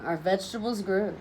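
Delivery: ordinary delivery, nothing marked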